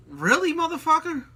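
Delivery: high-pitched disbelief at the trolls; sarcastic high voice calling out online haters; incredulous retort. Retold or imitated. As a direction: scoff at them, pitch way up. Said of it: "I'm like".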